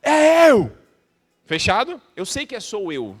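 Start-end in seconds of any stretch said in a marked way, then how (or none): 0.0-0.7 short punchy shout, much higher and louder; shouted reply. Shown as a demonstration. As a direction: bark it back with full body. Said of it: "com o seu corpo e com a voz falar". (grito curto e forte, bem mais alto)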